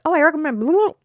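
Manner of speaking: mock podcaster. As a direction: mimic a careless podcast host. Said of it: "people recommend stuff on podcasts".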